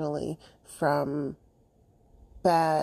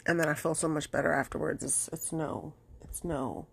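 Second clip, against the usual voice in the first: softer, close to tears; emotional and quiet; 6 dB quieter than usual. Real, not acted. Telling her viewers about her old dog, emotional and hushed. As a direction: let the feeling catch in a soft voice. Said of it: "I'm just emotional".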